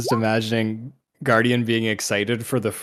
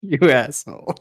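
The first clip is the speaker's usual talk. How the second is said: laughing; 5 semitones higher, 5 dB louder than usual. Real, not acted. Laughing at teasing.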